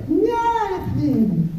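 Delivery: put-on high sing-song little kid denial; innocent kid. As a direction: squeak it out like a guilty toddler. Kid voiced denying.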